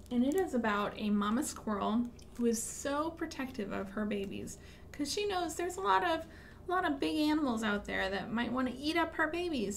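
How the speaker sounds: usual speaking voice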